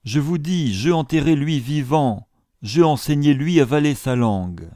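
insistent servant. A narrator's reading. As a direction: drive the point home to the doubter. Secundra insisting.

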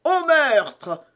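high, loud cry of murder; yelled alarm. A narrator's reading. Patrick alerting the house to murder, yelling. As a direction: raise the alarm with a yell. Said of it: "hurla Patrick".

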